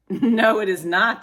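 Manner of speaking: indignant cowboy denial; emphatic declaration. A narrator's reading. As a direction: declare it firmly and loudly. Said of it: "declared Cowboy McCoy".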